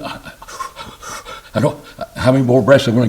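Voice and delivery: starts in a whisper, then rushes the question; a hammed-up fretful voice, fast and breathless; lightly voiced anxious believer counting breaths; mock worrier.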